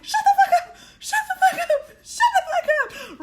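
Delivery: very high, loud shriek through laughter; high screamed laughter at a blabbing friend; laughing shriek. Retold or imitated. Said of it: "I was like".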